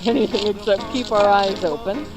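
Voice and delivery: normal talking voice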